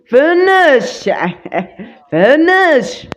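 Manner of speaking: long high drawn-out فنش; stretched finish call. Real, not acted. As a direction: drag out فنش while finishing him. Finishing off an enemy. (فنش ممطوطة بصوت مرتفع)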